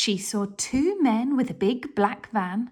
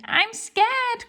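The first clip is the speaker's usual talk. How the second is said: much higher, frightened cry; scared cry; 13 semitones higher, 3 dB louder than usual. Acted. Hugh the thief crying out in fright in the dark. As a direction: cry out like a scared little boy. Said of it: "cried Hugh".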